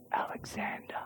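barely audible frightened whisper; nervous young soldier. Acted. Boy soldier answers the ruthless Alexander, very nervously. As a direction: answer in a tiny, scared voice. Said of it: "very nervously, shaking voice".

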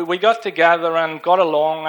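normal talking voice

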